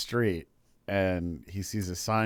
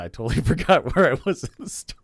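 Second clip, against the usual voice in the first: laughing, high and loud, rushing the words; 8 semitones higher, 8 dB louder, faster than usual. Real, not acted. Storyteller admits to friends he forgot the story, laughing. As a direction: crack up mid-sentence while confessing. Storyteller loses his place.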